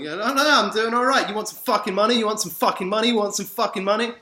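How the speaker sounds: overeagerly thrust it at them, mocking; frantic mock offer. Acts out reply, pushing money.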